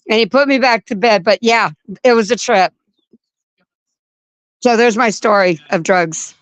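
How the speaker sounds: ordinary talk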